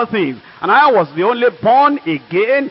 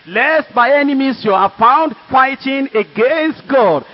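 slow, deliberate, raised warning to the professor; firm slow warning; 6 semitones higher than usual. Retold or imitated. Student warns head of department, slow and firm. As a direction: lay down each word as a slow warning.

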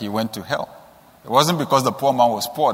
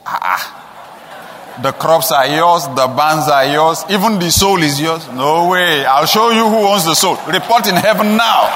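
louder, rising into a laughing rebuke; God's rebuke; 5 dB louder, slower than usual. Retold or imitated. God rebuking the rich man, louder and scornful. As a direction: scold him with a mocking chuckle. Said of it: "he said".

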